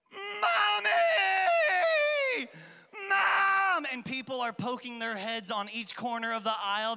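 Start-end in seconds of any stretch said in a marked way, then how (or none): 0.1-3.9 put-on high, loud child's cry for mommy; panicked shout for mom. Lost boy in the toy aisle calling for his mom, panicked shout. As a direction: scream for mom like a lost kid. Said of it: "I start panicking. And yes, I go".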